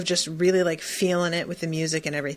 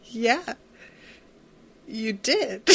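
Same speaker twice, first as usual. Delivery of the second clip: higher voice breaking towards a laugh; retold laughing reply; 4 semitones higher, 5 dB louder, much slower than usual. Eric laughs at his startled dad, amused. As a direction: imitate a son starting to laugh. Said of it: "And Eric starts laughing".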